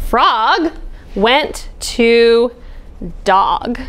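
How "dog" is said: plain reading voice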